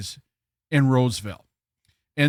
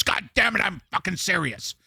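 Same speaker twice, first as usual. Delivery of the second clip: retold angry manager; 3 semitones higher, 5 dB quieter than usual. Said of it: "he's turning red".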